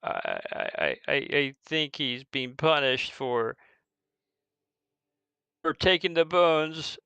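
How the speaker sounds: stammering, lightly acted sobs; mock sobbing. Orphan confessing his friend's misdeed, mildly tearful. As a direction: choke out the words haltingly. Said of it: "and he's sobbing".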